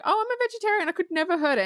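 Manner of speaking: high, prissy mock innocence; a lightly mocked high voice of the girl swearing she'd never hurt it; mock sweet vegetarian. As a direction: play the sweet animal lover. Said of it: "she was like".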